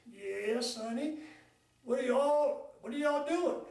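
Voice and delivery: a lightly voiced drawling old-timer; nursing home oldster greeting the visitors; old man voice.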